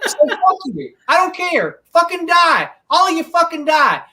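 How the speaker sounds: high, loud angry snap; ranting at people who skipped the shot; furious outburst. Real, not acted. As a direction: spit it out in anger. Angry rant at anti-vaxxers.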